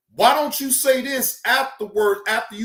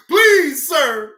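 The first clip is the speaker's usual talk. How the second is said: loud, high imitation of begging; mock pleading; 3 semitones higher, 6 dB louder than usual. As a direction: plead in mockery, hitting "please". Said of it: "Here come the begging and pleading".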